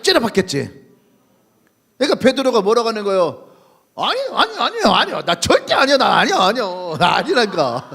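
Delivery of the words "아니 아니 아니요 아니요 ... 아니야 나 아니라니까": panicked denial. Acted. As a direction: deny it in a rising panic. Peter frantically denying.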